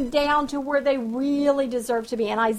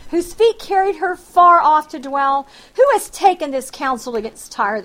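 forceful scripture reading; 6 dB louder than usual. Acted. Preacher proclaiming Isaiah's oracle on Egypt to the congregation, forceful reading. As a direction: proclaim the verse, a touch louder.

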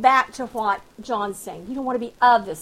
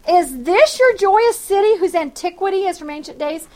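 forceful scripture reading; 4 semitones higher, 6 dB louder than usual. Acted. Preacher proclaiming Isaiah's oracle on Egypt to the congregation, forceful reading. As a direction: proclaim the verse, a touch louder.

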